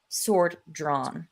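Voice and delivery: usual speaking voice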